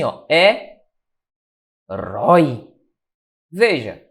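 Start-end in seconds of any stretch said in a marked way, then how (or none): 0.3-2.7 "É", long pause, then a lower "rói"; syllable by syllable. Shown as a demonstration. Teacher showing how herói divides, syllable by syllable. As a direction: split the word into "É" and "rói". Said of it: "a divisão de herói é assim".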